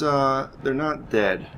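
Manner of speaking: ordinary talk, nothing marked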